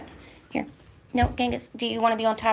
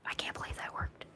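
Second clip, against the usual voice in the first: gasp, then whisper it in disbelief; hushed so the cats aren't startled; amazed whisper; 13 dB quieter than usual. Real, not acted. Streamer whispering to herself, amazed the cat trick worked.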